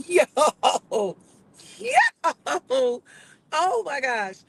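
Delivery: high, amazed yo yo; excited. Retold or imitated. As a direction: shout it in disbelief. Excited reaction to the video.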